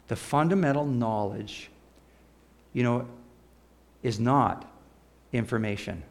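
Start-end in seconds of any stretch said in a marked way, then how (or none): none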